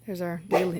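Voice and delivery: ordinary talk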